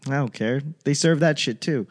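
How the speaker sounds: ordinary talk, nothing marked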